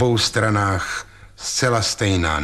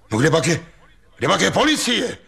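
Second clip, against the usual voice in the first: calling out; 8 semitones higher, faster than usual. A narrator's reading. Ostrohradský calls out for the police over the commotion. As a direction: call out over the crowd, half laughing. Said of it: "volal do toho Ostrohradský".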